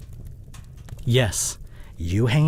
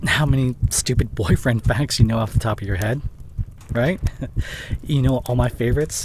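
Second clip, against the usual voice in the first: ordinary talk, nothing marked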